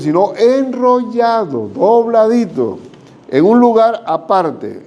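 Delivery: drawn-out tender emphasis. Acted. Preacher lingering tenderly on the folded grave cloths.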